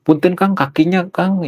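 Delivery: high, polite Sundanese mock complaint; imitasi Sunda lembut. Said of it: "Ngomongnya lembut banget". (menirukan orang Sunda menegur dengan lembut)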